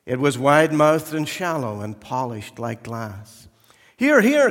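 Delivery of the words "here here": very high, loud eager cry; eager brass vessel. Acted. Brass vessel pushes itself at the master, shrill eager cry. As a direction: wave yourself forward eagerly. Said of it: "cried the vessel".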